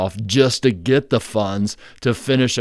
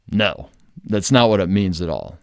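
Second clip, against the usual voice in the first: usual speaking voice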